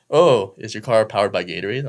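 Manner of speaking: loud, taunting mock question; mocking officer. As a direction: imitate a cop making fun of the driver. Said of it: "He's, like, mocking me".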